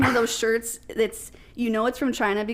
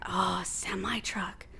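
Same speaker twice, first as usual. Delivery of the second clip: awed whisper; 3 semitones lower, 7 dB quieter, slower than usual. Retold or imitated. Spaniards react to new word.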